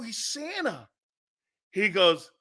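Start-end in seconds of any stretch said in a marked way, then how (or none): 0.0-0.9 put-on higher, softer child's voice insisting; lightly voiced kid, hurt and sure; little kid protesting. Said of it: "I go".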